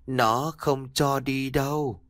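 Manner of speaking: slow, flat eerie warning; a strange girl speaking slowly; eerie child. A narrator's reading. Daughter warns her father not to leave, slow and eerie.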